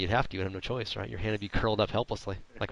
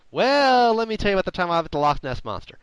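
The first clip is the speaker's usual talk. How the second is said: mock rambling guest; 9 semitones higher, 7 dB louder than usual. Guest answering an interview question with an off-topic yarn, mock rambling. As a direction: imitate a guest launching eagerly into a tall tale.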